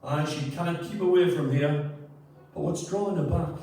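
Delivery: hammed-up Newcastle accent, reciting the rhyme; rubbish Newcastle accent. Reading a daft verse for the audience in a rough Newcastle accent. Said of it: "I'm sorry for the rubbish Newcastle accent".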